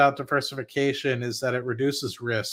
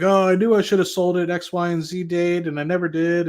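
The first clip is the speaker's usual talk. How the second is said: regretful investor; 5 semitones higher, 3 dB louder than usual. Retold or imitated.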